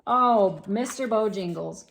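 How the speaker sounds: ordinary delivery, nothing marked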